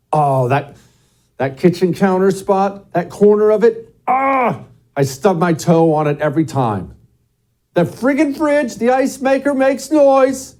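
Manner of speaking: high-pitched, hammed-up household grumbling; exasperated griping. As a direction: act out a pained, fed-up homeowner's complaint. Acting out a homeowner's gripes.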